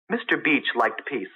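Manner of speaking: usual speaking voice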